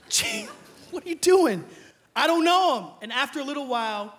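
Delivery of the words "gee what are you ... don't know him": high and loud, fed up; exasperated shout. Retold or imitated. Peter denying again.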